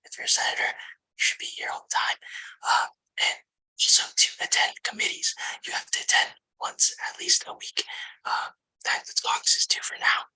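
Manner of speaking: steady unvoiced whisper into the mic. Real, not acted. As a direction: whisper the whole report, voice gone. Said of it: "Sorry, I have to whisper".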